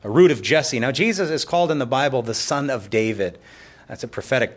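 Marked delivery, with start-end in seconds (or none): none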